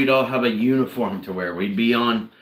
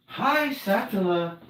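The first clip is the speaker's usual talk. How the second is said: higher, slow sing-song hello to the cat; sweet cat greeting; 10 semitones higher, 3 dB quieter, slower than usual. Real, not acted. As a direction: coo hello to the pet.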